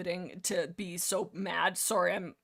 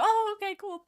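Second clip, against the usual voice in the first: much higher, sheepish mock cats voice; meek backdown; 11 semitones higher, 3 dB louder than usual. Clan cats caving to Midnight, high and sheepish. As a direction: squeak out a sheepish okay.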